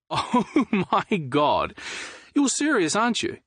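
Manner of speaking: high, laughing in amazement; laughing disbelief. Acted. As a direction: laugh through the words.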